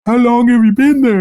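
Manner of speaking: high, loud startled cry; shocked shriek. Retold or imitated. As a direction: squeal it in sudden alarm. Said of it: "He's just like".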